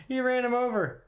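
high, laughing through the words; cracking up at the kill. Real, not acted.

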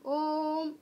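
ओम with the ओ stretched out long; prolonged Om. Shown as a demonstration. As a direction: hold ओम like a chant. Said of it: "उच्चारण करके भी देख सकते हो". (ओम को लंबा खींचकर बोलें)

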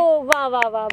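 low-pitched mocking wow; dry, mocking praise; sarcastic applause. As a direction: feign being impressed, sarcastically. Mock praise of sister's gift claim.

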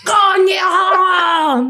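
high, slow shouted outburst; mock furious outburst. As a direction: bellow it like losing control. Acting out swearing outburst.

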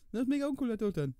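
ordinary talk

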